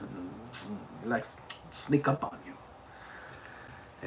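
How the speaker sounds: whispered, slow French accent; sneaky French-accented whisper. Shown as a demonstration. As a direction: creep up in a hushed French accent. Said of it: "he sometimes talks with a French accent".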